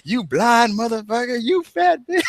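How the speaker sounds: high, strained cranky insult; Red Fox insulting. Retold or imitated. Red Fox cursing out the old lady, high and strained. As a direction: spit the insults like a furious old man. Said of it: "He's like".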